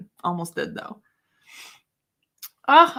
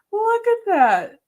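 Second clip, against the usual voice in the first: high, slow and louder, gushing; delighted squeal at the cat video; 11 semitones higher, 5 dB louder, slower than usual. Real, not acted. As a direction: coo over the cute video.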